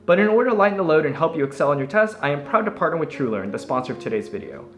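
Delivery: upbeat sponsor read voice. Acted. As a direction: sell it brightly like an ad.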